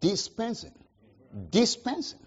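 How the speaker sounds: dispensing said with a rising stressed tune; stressed word. Shown as a demonstration. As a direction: lean on dispensing, pitch rising at the end. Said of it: "He stressed the word".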